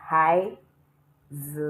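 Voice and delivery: "hai z" with z rising; wrong second tone. Shown as a demonstration. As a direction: lift the pitch through a drawn-out z. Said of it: "because there is no second tone here".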